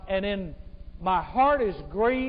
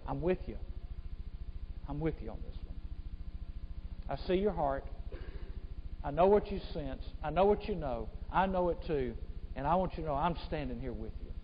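soft, low, intimate reassurance; comforting reassurance; 5 semitones lower, 8 dB quieter, faster than usual. Acted. Holy Spirit comforting someone who has confessed sin, soft reassurance. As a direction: drop right down and speak gently, as if alongside. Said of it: "the Holy Spirit comes alongside and says".

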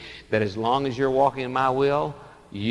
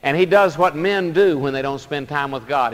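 normal talking voice

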